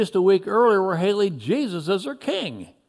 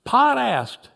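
ordinary delivery

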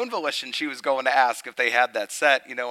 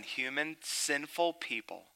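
ordinary delivery

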